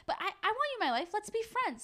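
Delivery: high-pitched Carrie voice. Retold or imitated. Carrie pleading with Big, voiced.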